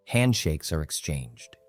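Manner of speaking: plain reading voice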